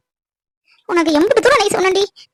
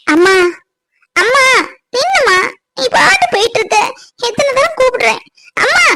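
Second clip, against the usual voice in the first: calling out; 5 semitones higher than usual. Acted. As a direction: call out after her, desperate. Daughter calling after her mother. (அம்மாவை உரக்க கூப்பிடுதல்)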